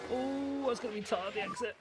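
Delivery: tense excitement. Real, not acted. Tense pit exit.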